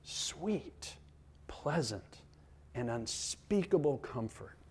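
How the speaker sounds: very soft, spaced-out words; hushed savouring. Real, not acted. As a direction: savour each word quietly. Savouring the quoted words again.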